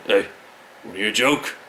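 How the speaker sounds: a put-on gruff rocker voice. Lightly put-on gruff rocker. [name] offers a joke.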